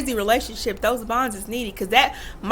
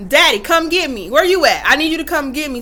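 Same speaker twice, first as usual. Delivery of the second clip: louder, urgent mock call for dad; urgent daughter; 4 semitones higher, 7 dB louder than usual. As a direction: call for dad like you need rescuing. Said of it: "type of daddy".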